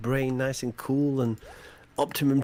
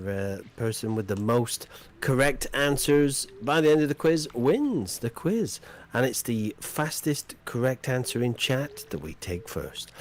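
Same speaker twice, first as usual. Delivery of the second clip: ordinary talk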